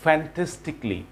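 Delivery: "Fantastically" ending in "klee"; correct pronunciation. Shown as a demonstration. Teacher repeats the corrected word, correct pronunciation. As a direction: say "Fantastically" as "fan-tes-tik-lee". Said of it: "বলতে হবে ক্লি".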